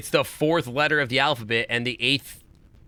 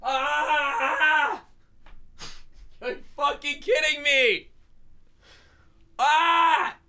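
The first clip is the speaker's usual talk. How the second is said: very high-pitched, drawn-out cry of outrage; real meltdown, voice shooting way up; exasperated wailing; 12 semitones higher, slower than usual. Real, not acted. Streamer raging at a silly puzzle answer, exasperated wail.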